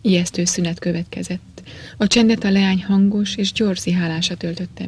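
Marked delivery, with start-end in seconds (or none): none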